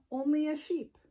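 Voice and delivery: ordinary delivery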